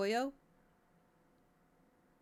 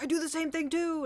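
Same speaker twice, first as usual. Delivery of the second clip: excited agreement; 9 semitones higher, 4 dB louder than usual. Retold or imitated. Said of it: "Gage is like".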